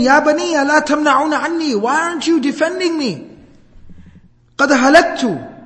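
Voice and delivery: acted cry for help; mock screaming. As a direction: cry out desperately to the family, short of a real scream. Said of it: "he keeps on screaming".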